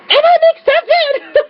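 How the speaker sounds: high, drawn-out squeal of joy; very high, slow squealed announcement; excited outburst. Retold or imitated. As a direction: burst out screaming the good news. Acted burst after playing it down.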